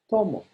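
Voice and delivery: "tomo" with TO punched, mo falling; stress on first syllable. Shown as a demonstration. Teacher gives the I-drink form, stress on TO in "tomo". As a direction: hit TO in "tomo". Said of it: "I drink".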